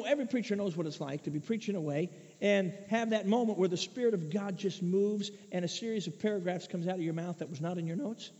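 ordinary delivery

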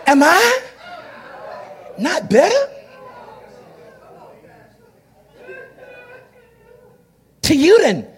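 lightly hammed-up husband; smug husband. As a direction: sweet-talk her proudly. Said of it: "he said".

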